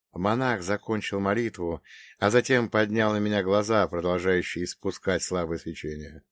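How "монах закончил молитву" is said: plain reading voice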